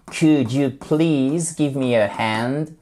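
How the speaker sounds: "Could you please give me a hand?" said slowly and clearly; slow model phrase. Shown as a demonstration. Teacher drills the class, slow and clear. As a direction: spell it out slowly for the learners. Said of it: "on prononce pas le L".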